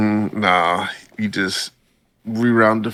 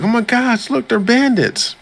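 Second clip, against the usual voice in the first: much higher, excited mock character voice; 3 dB louder than usual. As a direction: squeak it out like an eager anime hero. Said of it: "And Inokami's like".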